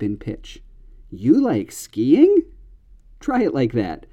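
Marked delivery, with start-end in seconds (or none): 1.1-2.4 skiing swoops way up, slow and higher; exaggerated echo question. Shown as a demonstration. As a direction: push the rise on skiing sky-high. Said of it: "really exaggerate the way your voice goes up".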